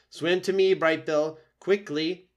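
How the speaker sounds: calling the gosling to safety; urgent call. Acted. Roz calling her son.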